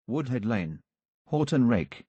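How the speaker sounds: normal talking voice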